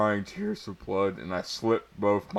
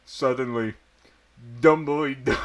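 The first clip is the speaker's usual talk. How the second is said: ordinary talk, nothing marked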